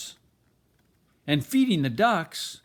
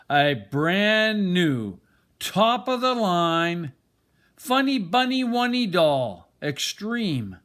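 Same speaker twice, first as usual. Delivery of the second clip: slow, higher and louder, like a toy advert; toy commercial announcer; 4 semitones higher, 4 dB louder than usual. A narrator's reading. Grandparents presenting a surprise toy to Trixie, announcer hype. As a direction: hype it up like a TV ad for kids.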